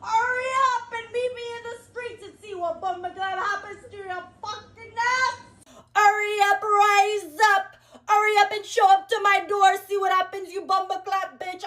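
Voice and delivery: high-pitched, hammed-up Jamaican accent. Daring a stalker to meet up, high Jamaican accent. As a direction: threaten in a mock Jamaican patois. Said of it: "I was Jamaican for a second".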